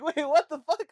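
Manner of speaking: loud, high, laughing disbelief; shocked outburst. Retold or imitated. Reacting to manager's odd test question, shocked outburst. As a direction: burst out incredulous through laughter.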